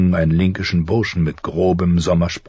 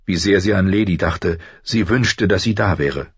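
usual speaking voice

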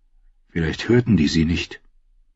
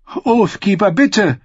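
pleading cry; 13 semitones higher, slower than usual. A narrator's reading. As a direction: beg for the sword at the top of your voice. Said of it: "rief sie".

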